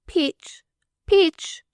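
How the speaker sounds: put-on a small girl calling her nurse; little girl calling. A narrator's reading. Little girl calling her nurse from the porch. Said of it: "called the little crippled girl".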